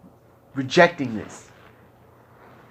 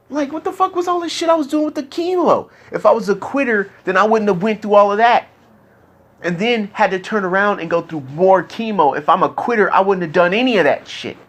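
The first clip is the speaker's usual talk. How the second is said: much higher and louder, raw frustration pouring out; real angry outburst; 6 semitones higher, 4 dB louder than usual. Real, not acted. Venting to his friend about the chemo, real angry outburst. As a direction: let the anger break through, voice pitched up.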